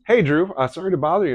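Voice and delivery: politely sorry to intrude; wife calling Drew, high apologetic voice; wife's voice. Retold or imitated. Wife voiced in retold call.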